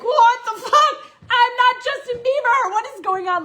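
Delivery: high, loud acted-out shock; overwhelmed disbelief. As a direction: wail it in hammed-up bewilderment. Acting out her overwhelmed reaction.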